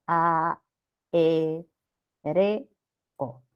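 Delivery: "A, E, R, O" as four isolated beats, a, e, re, o; syllables separated. Shown as a demonstration. Teacher dividing aéreo for students, "A, E, R, O" one syllable at a time. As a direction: pause between each syllable of "A, E, R, O". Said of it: "vamos a separar así".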